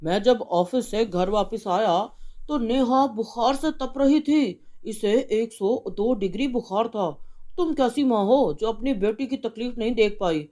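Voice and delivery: a husband furious about the fine; angry father. Acted. Father rebukes wife.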